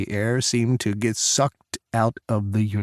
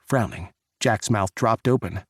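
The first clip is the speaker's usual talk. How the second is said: ordinary delivery, nothing marked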